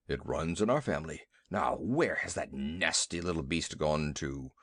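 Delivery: a hint of low elephant rumble, fussy; lightly voiced elephant. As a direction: grumble like a jumpy old elephant. Narrator voices elephant Two Tails.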